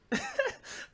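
sudden high-pitched squeal of a laugh; a laugh that jumps way up. Real, not acted. Viewer cracking up at the scene, squealing laugh.

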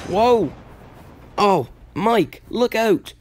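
high-pitched startled cry at normal volume; alarmed warning. Acted. Engine warns Mike to look out, acted alarm.

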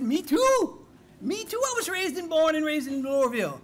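high, loud burst of happy recognition; hammed-up, high-pitched excitement; excited delight. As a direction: imitate a bar patron thrilled by a coincidence. Fella delighted at shared hometown.

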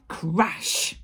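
loud, drawn-out crash; crashing sound effect. A narrator's reading. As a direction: say it like something huge smashing down. Wolf trips over the tree.

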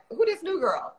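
who-does-she-think-she-is quote; mock skeptical audience. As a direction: question the newcomer like a wary viewer. Voicing a resistant audience's reaction.

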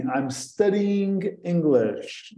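very slow, careful I'm studying English; slow model sentence. Shown as a demonstration. Teacher reading a student's answer slowly. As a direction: spell it out slowly for learners. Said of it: "François diz assim".

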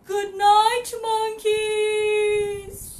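loud cooing good night; Mama Monkey. Acted. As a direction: sing good night like a mother. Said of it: "And Mama Monkey says".

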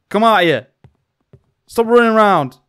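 loud call into the dark. Real, not acted. Player calling to unseen little runners, commanding shout. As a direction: order the hidden runner out. Calls to unseen running children.

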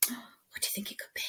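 quick excited whisper; fast hushed guessing game. Acted. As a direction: rush the question in a whisper. Suspense before the puppy.

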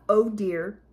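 ordinary delivery, nothing marked

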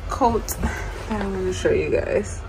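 ordinary talk, nothing marked

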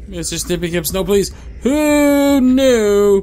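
panicked high screaming pleas; terrified screaming. Real, not acted. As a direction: scream for mercy as it grabs you. Chased by monster, screaming.

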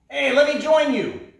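high, loud eager call to the crows. Acted. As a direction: shout up to the birds, all eagerness. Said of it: "Coyote called to the crows".

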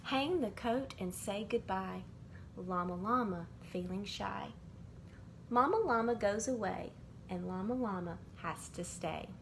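ordinary delivery

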